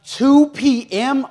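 slow, high, loud drawn-out "Two P M"; excited. Retold or imitated. Preacher picturing the two o'clock service, thrilled. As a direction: stretch it out with glee.

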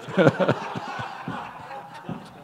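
airy unvoiced laughter; breathy laugh. Real, not acted. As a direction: laugh out silently at yourself. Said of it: "I feel like the weatherman".

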